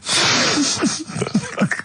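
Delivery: airy unvoiced chuckle; breathy laugh. Real, not acted. Laughing at own clue.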